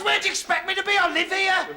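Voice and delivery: high-pitched indignant retort. Acted. Kevin answers the programme boss at his own door, indignant. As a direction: throw it back, pitched right up.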